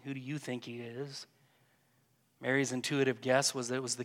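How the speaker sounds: quiet, gentle meditation voice; calm reflective prayer guide; guided meditation. Acted. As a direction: keep them in the scene softly. Continues guiding the imagined scene.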